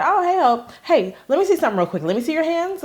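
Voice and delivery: put-on sing-song, pushy kid voice, pitched well up; imitating a girl who demands to see her hands. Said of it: "she said".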